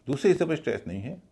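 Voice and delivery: ordinary delivery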